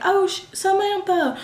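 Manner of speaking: imitating a girl in the background; background classmate. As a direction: call her name like a kid in the crowd.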